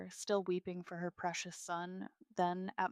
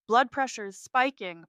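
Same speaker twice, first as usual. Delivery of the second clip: raised, loud alert; urgent report; 5 semitones higher, 9 dB louder than usual. A narrator's reading. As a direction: report an emergency fast to the team. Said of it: "Piper reports".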